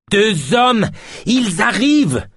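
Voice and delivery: very high, alarmed cry; rising shrill panic. A narrator's reading. Yosp panics at the two men coming, voice climbing. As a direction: get shriller with every syllable. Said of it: "augmentait à chaque syllabe, de plus en plus aiguë".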